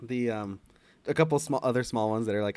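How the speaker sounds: ordinary delivery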